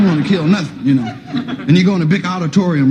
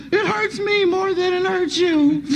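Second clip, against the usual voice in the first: mother; 12 semitones higher, 4 dB quieter, slower than usual. Retold or imitated. Mother quoted.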